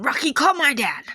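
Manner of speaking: high, loud urgent call-out; retold cry for help.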